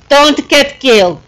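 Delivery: ordinary talk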